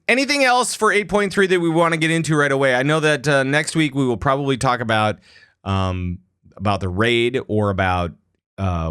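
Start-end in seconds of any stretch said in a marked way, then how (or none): none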